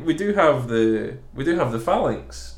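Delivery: ordinary talk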